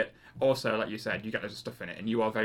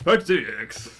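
French accent attempt; 5 dB louder, much slower than usual. Shown as a demonstration. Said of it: "What was that?".

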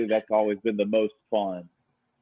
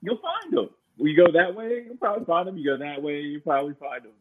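exasperated; 8 semitones higher than usual. Retold or imitated. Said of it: "I'm like".

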